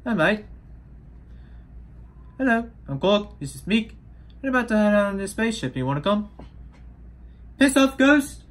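chirpy-high Kiwi accent impression; Korg's New Zealand accent. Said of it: "it has this New Zealand accent".

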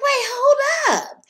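high, loud, incredulous yell; retold shout. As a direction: shout it in disbelief like she can't believe it. Retelling a shocked argument.

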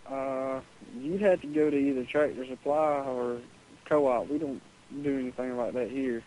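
ordinary talk, nothing marked

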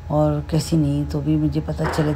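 usual speaking voice

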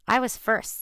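lightly squeaked mouse voice; squeaking mouse. A narrator's reading. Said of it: "squeaked the mouse".

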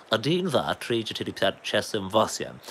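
posh English accent on Russian numbers; posh Englishman. Shown as a demonstration. Counting to eight in Russian, posh Englishman's accent. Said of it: "really posh Englishman saying Russian".

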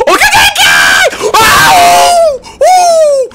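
high, loud yells; excited yelling. Real, not acted. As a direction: yell it mid-fight. Gamer yelling mid firefight.

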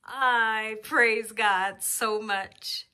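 ordinary talk, nothing marked

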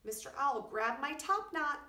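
calling for help. A narrator's reading. Quincy calls owl for help.

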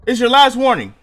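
loud, hard warning shouted; mock tough cop. Player warning an unseen threat like a cop, loud mock tough talk. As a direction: play the armed cop giving a last warning.

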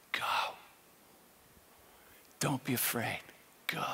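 very soft, low, spaced-out words; hushed inner voice. Acted. Jesus answering his first prayer, hushed inner voice. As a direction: breathe it gently into his head with long pauses.

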